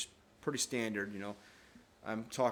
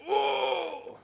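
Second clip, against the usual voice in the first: pained yelp; 8 dB louder than usual. Retold or imitated. Brother punched in the nuts.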